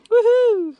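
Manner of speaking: high, loud celebratory woohoo; excited whoop. Real, not acted. Scrapper cheering a brass rod find, high excited whoop. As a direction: whoop loudly, pitch soaring.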